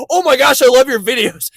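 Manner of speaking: high, loud starstruck gush; loud, high fan excitement. Retold or imitated. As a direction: squeal it like a fan meeting their idol. Excited fan spotting him.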